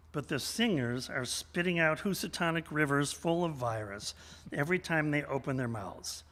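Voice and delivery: ordinary talk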